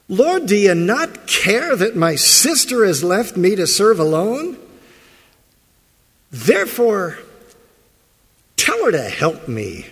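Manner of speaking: loud, high, exasperated demand; blurted demand. Retold or imitated. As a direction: blurt it out at him, fed up. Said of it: "blurts it out".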